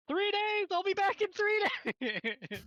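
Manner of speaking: high, loud, slow mock goblin cry; goblin's yell. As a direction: belt it out like a fleeing goblin. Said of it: "expecting him to go".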